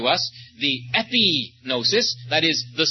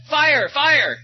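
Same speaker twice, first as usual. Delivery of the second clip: high, loud cry of alarm; yelled alarm; 11 semitones higher, 4 dB louder than usual. Retold or imitated. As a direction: call it out like a street alarm, pitch jumping up. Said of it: "he'd yell".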